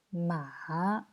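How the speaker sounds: "Ma" dropping low, then lifting; third tone. Shown as a demonstration. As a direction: dip each "Ma" down and back up. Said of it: "je répète les quatre tons".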